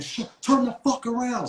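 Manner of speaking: high mock cop order; cop barking. Imitating the cop telling him to turn around, high. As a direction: snap the order like an officer.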